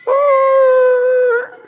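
high loud yelp of surprise; imitated surprised yelp. Preacher imitating the driver's startled yelp. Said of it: "he literally goes".

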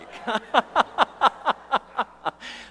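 high, rapid staccato laugh; a run of high ha-ha-has; laughter. Real, not acted. Laughing at his own blunder.

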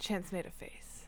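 unvoiced aside; whisper. Real, not acted. Host telling listeners about her boyfriend, whispered aside.

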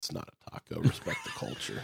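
mock-solemn whisper. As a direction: hush it like a sacred rule. Mock offence, whispered aside.

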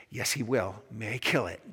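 low, controlled angel voice. Acted. Angel contradicting the ghost, low and firm. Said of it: "But the angel says".

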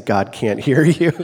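loud, slow mock scolding with laughter; teasing quote. Wife teasing husband in the front row, loud mock scolding. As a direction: belt it out slowly, amused. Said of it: "you know what she's saying to me".

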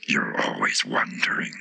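very low, slow flower voice; dismissive Tiger Lily. A narrator's reading. The Tiger Lily interrupts the Rose, low and dismissive. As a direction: put the Rose down low and slowly.

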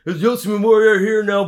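mock eager fan. Host mocking a casual fan at the wrestling show, high gushing voice. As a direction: gush like a wide-eyed fan. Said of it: "I wouldn't be going there like".

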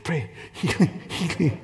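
soft, token mock praying; half-hearted prayer. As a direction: pray from a safe distance, barely trying. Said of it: "just, like, pray for, like, this".

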